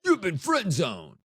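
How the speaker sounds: slow announcer boom; "You've been friend zoned" like a game show buzzer; button announcer voice. Pretend. Imagined show button announcing to guests, slow. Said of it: "a button that I can push that says".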